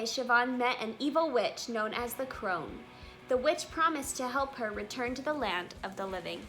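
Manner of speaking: plain reading voice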